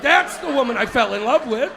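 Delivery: ordinary delivery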